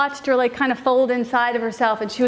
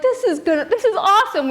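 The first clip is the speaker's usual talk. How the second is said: higher-pitched gleeful excitement; thrilled with your own prank; delighted kids; 7 semitones higher than usual. Retold or imitated. Sisters delighted by their prank, excited high voices.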